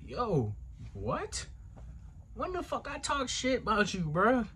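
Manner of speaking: high-pitched, indignant comeback; indignant disbelief. Retold or imitated. Answering a threatening phone call about talking shit, indignant and baffled. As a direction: jump up high, taken aback by the accusation.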